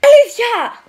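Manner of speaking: surprised shout. Acted. Sudden shout in game.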